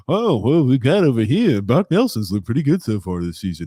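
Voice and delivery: mock play-by-play announcer; imitating the commentators you won't be; play-by-play guys. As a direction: put on a loud sportscaster's patter. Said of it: "We're not guys who go".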